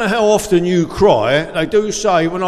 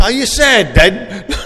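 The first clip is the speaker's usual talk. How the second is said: higher, louder mock kid voice; child's question; 4 semitones higher, 5 dB louder, slower than usual. Kids ask Dad about his tears, imitated child's voice. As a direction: put on a child asking Dad. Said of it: "your kids say".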